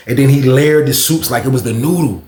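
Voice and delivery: usual speaking voice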